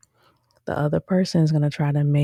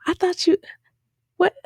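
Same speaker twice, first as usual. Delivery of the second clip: very high, stunned protest; shocked disbelief. Retold or imitated. Fan reacting to the anticlimactic reveal, squealing disbelief. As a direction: squeal your disbelief at the screen. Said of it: "I was like".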